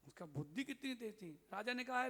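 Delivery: ordinary delivery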